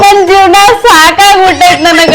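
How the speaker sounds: slow, drawn-out comic wail; hammed-up weeping, stretched words; crying. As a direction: sob that you are fed up with life. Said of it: "ಅಳಬೇಡ, ಅಳಬೇಡ".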